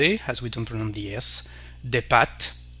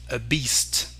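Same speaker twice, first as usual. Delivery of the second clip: ordinary delivery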